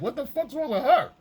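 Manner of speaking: very high, bewildered squeal; mock baffled boyfriend. Boyfriend baffled by her slamming dishes, very high and squealed. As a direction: squeal it in disbelief. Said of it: "you're like".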